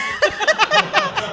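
high burst of laughter. Real, not acted. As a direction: crack up at the memory. Laughing at own story.

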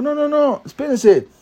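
high, urgent no no no; high excited onlooker cutting in; urgent correction. Retold or imitated.

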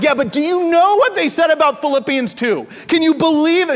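high, loud excited protest; worked up. Retold or imitated. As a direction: fire it back all indignant. Excited retort to wife.

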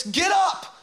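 high, forceful rousing cry; commanding shout easing into urgent appeal; urgent captain. Acted. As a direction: rouse the sleeper as the storm rages. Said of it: "The captain went down to him and said".

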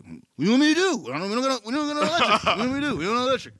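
mock Kevin. Kevin quoted, exasperated excuse.

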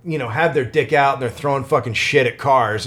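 ordinary talk, nothing marked

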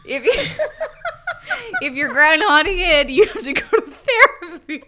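words through laughter; giggling as she repeats it; laughing. Real, not acted. Repeating joke while laughing.

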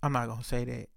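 hushed, low-pitched hedging; subtle tone. Retold or imitated. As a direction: understate it, quietly holding back. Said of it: "using, like, the tone of her voice".

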